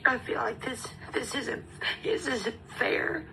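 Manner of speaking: trying not to cry while saying it isn't fair. Real, not acted. Mother missing her daughter's field trip, crying. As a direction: voice breaking high as she fights tears. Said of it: "sorry, I'm trying not to cry".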